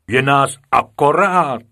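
loud, proud teacher's report; booming, self-satisfied declaration; proud announcement. A narrator's reading. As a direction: announce it proudly at full volume. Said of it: "hrdě hlásil učitel".

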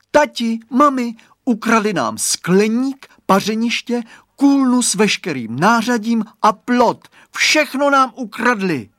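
put-on loud, high-pitched child's cry of alarm; alarmed calling out. A narrator's reading. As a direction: shout to the parents in a panic. Boy alarmed, calls parents about theft.

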